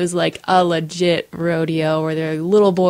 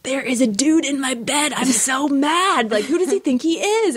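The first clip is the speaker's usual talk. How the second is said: mock indignant; 8 semitones higher than usual. Half asleep, angry at a man in her bed, high mock outrage. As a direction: play it hammed-up offended, pitched high.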